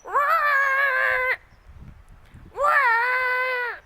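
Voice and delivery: high, loud, drawn-out whir; screeching tire whir. Shown as a demonstration. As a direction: squeal it high and long like tires round a corner. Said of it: "the tires go whir".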